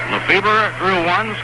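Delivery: ordinary talk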